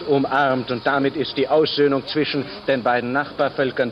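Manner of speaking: put-on old crackly radio recording; vintage broadcast sound; gritty early microphone. Historic 1963 radio reporter.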